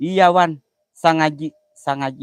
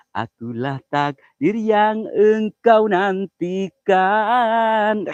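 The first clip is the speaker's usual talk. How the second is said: slow, drawn-out poetic lines, a little higher; slow romantic recitation; 5 semitones higher, 5 dB louder, much slower than usual. Acted. Streamer reciting love verses to viewers, slow and tender.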